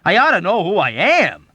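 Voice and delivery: loud, high indignation; exasperated, emphatic outburst; exploding. A narrator's reading. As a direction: burst out in protest. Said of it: "Yossarian exploded".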